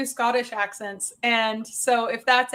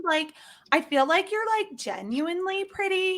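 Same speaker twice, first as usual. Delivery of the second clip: put-on higher-pitched Valley Girl flattery; 5 semitones higher, 3 dB quieter than usual. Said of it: "What's the Valley Girl?".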